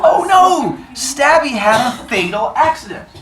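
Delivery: high, hammed-up dismay; mock shock. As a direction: gasp at the news playfully. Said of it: "we would go".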